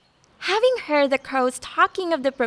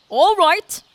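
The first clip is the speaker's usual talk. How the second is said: bright, louder deer reply; 6 semitones higher, 6 dB louder than usual. Acted. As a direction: answer eagerly as the deer. Deer answers the snail's challenge.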